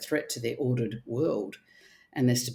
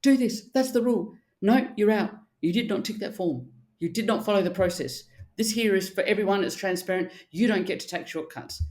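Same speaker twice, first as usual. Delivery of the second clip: mock army sergeant major; 6 semitones higher than usual. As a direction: play a strict drill sergeant laying down rules. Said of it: "like sergeant majors in the army".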